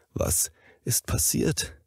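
slow, weak whisper; patient whispering after a heart attack. Acted. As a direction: breathe it out barely able to speak. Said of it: "flüsterte er".

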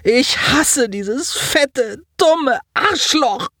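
hateful outburst. A narrator's reading. Simon's furious grieving outburst.

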